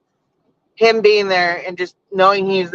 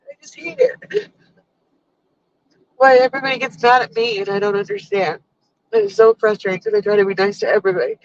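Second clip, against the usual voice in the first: sobbing out the words, voice jumping high; choking on frustration, real tears; crying; 3 semitones higher than usual. Real, not acted. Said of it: "I don't know why I'm crying".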